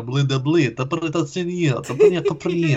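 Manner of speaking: mock Russian accent gibberish, imitating Russian men talking. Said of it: "Russian is the most don't give a fuck accent".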